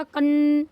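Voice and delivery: कन with a loud, long humming n; drawn-out anusvara. Shown as a demonstration. Teacher swapping the first letter, held nasal. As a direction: stretch the n in कन. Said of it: "इस तरीके से".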